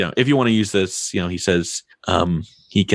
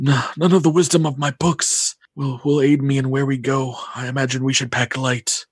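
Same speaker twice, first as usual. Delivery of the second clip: lightly voiced old mystic; a hint of an old seer; fortune teller; 5 semitones higher than usual. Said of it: "and says".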